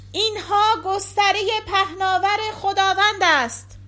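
high, loud proclamation; loud cry. A narrator's reading. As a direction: call it out aloud, pitched well up.

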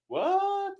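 very high, squeaky surprise; acted shocked wife; mock disbelief. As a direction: shoot up high in exaggerated surprise. Acts out wife hearing engagement news.

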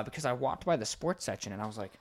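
usual speaking voice

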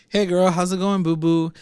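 high, sing-out hello; breezy mock greeting. Ambrose greeting Sabrina at home, breezy mock greeting.

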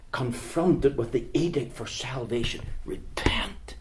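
whispered urgent plea; hushed whisper. Acted. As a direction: drop to a whisper for effect. Dramatic hushed plea to repent.

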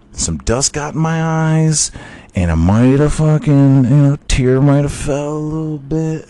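high, loud mock-emotional excuse; hammed-up high voice, playing at tearfulness; mock choked up. Fan jokingly admits tearing up at the game, mock choked up.

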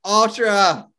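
loud, high announcer shout; festival announcer. Acted. Revealing the month's big festival to co-host, hyped announcer shout. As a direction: belt it out like an event announcer. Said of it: "I sound like the guy".

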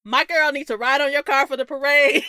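hollering at a passing car; best friend flagging down a stranger, loud call. Retold or imitated. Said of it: "She's like".

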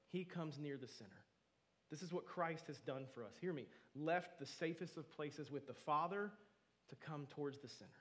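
ordinary talk

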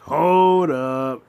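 stunned hold up. Retold or imitated. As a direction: stop everything, you can't believe it. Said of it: "I'm like".

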